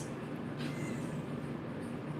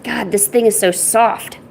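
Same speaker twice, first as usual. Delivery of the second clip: ordinary talk, nothing marked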